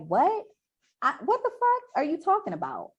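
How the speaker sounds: high-pitched, incredulous challenge; indignant disbelief. Acted. Acting out a heated argument.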